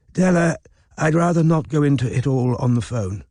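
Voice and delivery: a hint of embarrassment in a frail old voice; an old man shying away, mildly awkward; embarrassed old man. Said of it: "sounding embarrassed".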